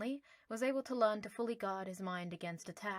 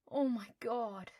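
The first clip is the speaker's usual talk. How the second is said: coo; 3 semitones higher, 3 dB louder, slower than usual. Acted. Harry cooing over newborn owlets. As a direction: coo at the baby owls. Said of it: "Harry cooed".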